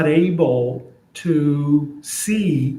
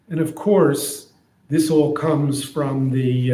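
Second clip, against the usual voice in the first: ordinary talk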